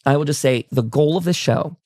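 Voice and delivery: normal talking voice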